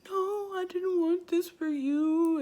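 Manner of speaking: mock a tearful mother's high lament; imitated crying mom. Mom disappointed for her daughter, imitated crying. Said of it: "Like, she just cried".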